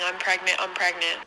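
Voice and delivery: ordinary talk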